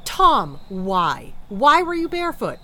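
loud, exasperated demand for an explanation; exasperated scolding. Acted. Wife demanding why husband went barefoot among broken glass, loud exasperation. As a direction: raise your voice at a hapless husband.

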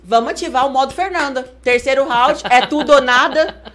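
loud, high retold pep talk; coaches' rallying call. Coaches urging her on before the third round, imitated loud rallying call. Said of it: "Aí eles".

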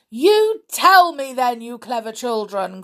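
put-on pleased giant urging the children; delighted. A narrator's reading. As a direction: leap high on the first words, then settle.

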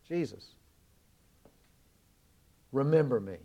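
dying thief humbly asking Jesus, soft and low; humble plea. Retold or imitated. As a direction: plead gently and quietly. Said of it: "I think in real humility".

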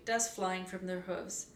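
usual speaking voice

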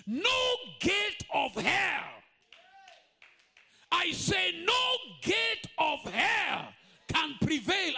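high, slow, shouted preaching over music; full-force preaching. Acted. Preacher declaring to the congregation, high and shouting. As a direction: thunder it out to the congregation.

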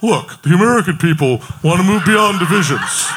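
mocking fake deep politician voice; dopey deep voice. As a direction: imitate a phony, put-on deep voice. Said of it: "this phony guy with this fake deep voice".

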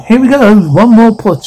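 high-pitched birth coaching; urging encouragement. Acted. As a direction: cheer her on through the push. Coaching a woman giving birth.

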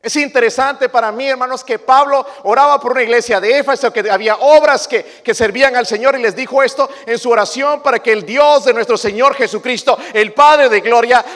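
loud, high rising sermon cry; fiery preacher hammering the point; preaching at full force. Acted. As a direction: build it up to a shout.

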